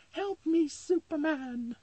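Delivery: high falsetto damsel in distress; Lois Lane. Acted. Damsel calling the hero to save her, shrill mock cry. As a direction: wail for rescue, stretching the last word. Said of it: "she'll be like".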